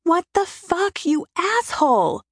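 high, slow, anguished scream at the sky; screaming at God. A narrator's reading. Standing in the rain screaming her rage up at God. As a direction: howl it up to heaven. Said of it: "I scream it to God".

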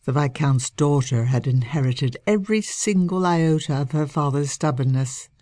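ordinary delivery

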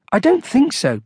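high, hesitant polite reply; uncertain. A narrator's reading. Shopper answering a frightened monk, uncertain. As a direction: answer unsure, voice lifted high. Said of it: "said Mister Jones uncertainly".

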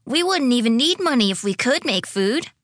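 put-on high, giddy little-boy voice; highly amused. A narrator's reading. Dante jokes about not needing money, giddy. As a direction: point it out in childish glee. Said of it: "doubling over with laughter".

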